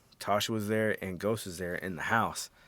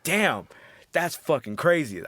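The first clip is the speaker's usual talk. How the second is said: excited; 6 semitones higher, 5 dB louder, slower than usual. Retold or imitated. Excited reaction to scene.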